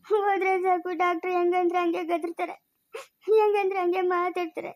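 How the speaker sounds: helpless tearful telling, sobs between lines; crying. Acted. Mother tells how nobody supported her, crying. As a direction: recount the loss while weeping. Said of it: "ಅಳ್ಬೇಡ ಸುಮ್ನಿರು".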